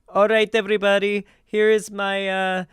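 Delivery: high, put-on German accent; bad German accent impression. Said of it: "I'm trying to do a German accent".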